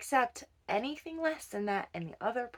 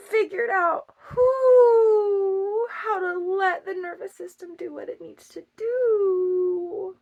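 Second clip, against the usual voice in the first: crying; 10 semitones higher, 11 dB louder, much slower than usual. Real, not acted. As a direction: voice breaking into a sob. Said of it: "that was a silly noise".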